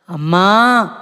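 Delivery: a mild mock cry for mother; retold scream. Daughter retells screaming for her mother, a mild mock yell. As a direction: cry out for mother, only half-voiced. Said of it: "అని గట్టిగా అరిశాను".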